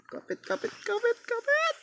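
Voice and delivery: squeaky high-pitched urging; frantic high hold on. Real, not acted. Gamer pleading with his character, high panicked voice.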